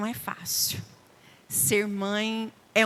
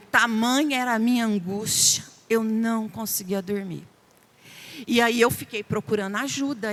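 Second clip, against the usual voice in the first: ordinary delivery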